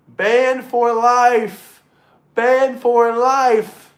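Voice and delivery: very slow, loud, high proclamation; mock verdict. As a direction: drag out each word like a judge's sentence.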